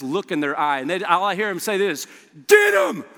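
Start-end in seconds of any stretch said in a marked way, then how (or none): 2.5-3.0 loud, high shout; war cry. Retold or imitated. Hungry students spotting the chicken bags, shouting high and loud. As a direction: yell it like a charge into battle.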